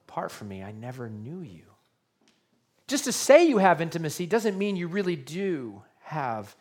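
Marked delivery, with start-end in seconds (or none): 0.1-1.6 low, soft, final verdict; quiet stern rejection. Retold or imitated. Preacher voicing Jesus turning them away, low and quiet. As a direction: drop the voice for the verdict.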